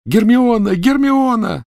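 very high, loud, drawn-out scream; yell. A narrator's reading. Ron yelling for Hermione from the cellar. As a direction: bellow her name in anguish. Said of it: "Рон снова заорал".

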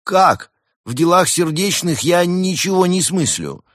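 high, loud, baffled protest; taken-aback protest. A narrator's reading. Koala asked to help in a love matter, protesting. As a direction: burst out in high-pitched dismay.

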